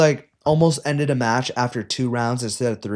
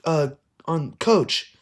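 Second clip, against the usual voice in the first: high, mock-official ref voice; 6 semitones higher than usual. Coach mocks the ref calling a technical on him.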